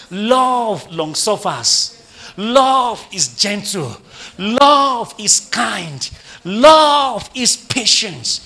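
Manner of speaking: slow, rising proclamation; emphatic preaching refrain. Real, not acted. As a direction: stretch and swell each opening word. Preacher declaims a list on love.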